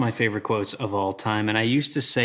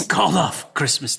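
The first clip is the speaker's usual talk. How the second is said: slow drawn villain line; Sheriff of Nottingham; 4 semitones higher, 3 dB louder than usual. Retold or imitated. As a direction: savor it like a hammy villain. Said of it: "the Sheriff of Nottingham".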